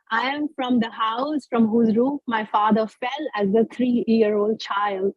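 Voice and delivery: ordinary delivery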